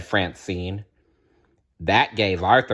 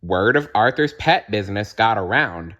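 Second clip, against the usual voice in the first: usual speaking voice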